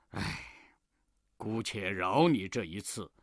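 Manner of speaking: sighing, low and soft; reluctant pardon. A narrator's reading. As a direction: give in with a sigh, quietly. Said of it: "唐僧只好说". (叹着气无奈地低声饶恕)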